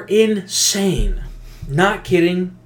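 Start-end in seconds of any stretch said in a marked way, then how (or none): none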